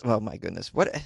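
usual speaking voice

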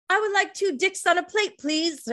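high put-on foreign accent, quoting Kiki; a jokey accent ordering from the waiter; hammed-up vague foreign accent; mock unnamed accent. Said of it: "I don't know what accent that was".

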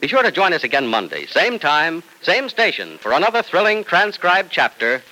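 vintage radio sign-off; old radio announcer. Acted. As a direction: announce the next episode brightly.

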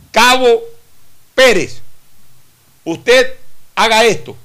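loud curt military command; barking sergeant. Retold or imitated. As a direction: bark the order like a drill sergeant. Sergeant giving an order.

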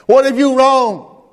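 wife pushing back on her husband, slow and urgent. Retold or imitated. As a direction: voice the wife's worried challenge. Wife challenging pastor's decision.

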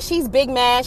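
ordinary delivery, nothing marked

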